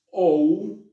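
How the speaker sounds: ou said high and loud like Spanish u; diphthong ou. Shown as a demonstration. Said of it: "se pronuncia como nuestro U". (diptongo ou, como nuestra u)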